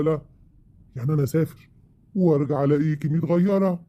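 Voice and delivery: ordinary talk, nothing marked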